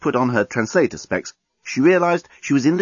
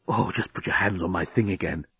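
soft, lower, hushed coaxing; amorous whisper; 4 semitones lower, 7 dB quieter than usual. Acted. Alien whispering in Lucy's ear, hushed and amorous. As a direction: murmur it softly into an ear. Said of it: "he was whispering in her ear".

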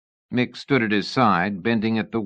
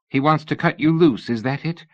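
a worried lady pressing for answers, pitched up; young lady Sybil, anxious; 6 semitones higher, 3 dB louder than usual. A narrator's reading. Narrator voices Sybil.

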